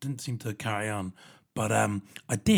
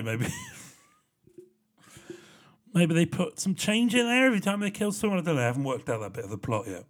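usual speaking voice

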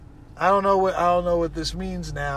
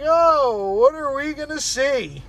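excited; 9 semitones higher, slower than usual. Retold or imitated. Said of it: "So I'm like".

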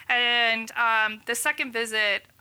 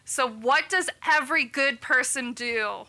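ordinary talk, nothing marked

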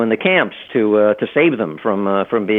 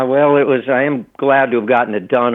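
normal talking voice

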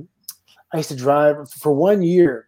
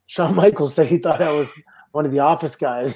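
normal talking voice

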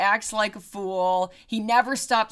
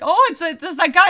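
mock eager reply; 8 semitones higher, 9 dB louder, faster than usual. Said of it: "And you were like".

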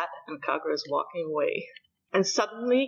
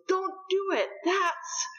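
much higher pleading cry; mock desperate plea; 12 semitones higher, much slower than usual. Kondo rushes in to save the beetle, mock pleading. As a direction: beg them to stop. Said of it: "Kondo and goes".

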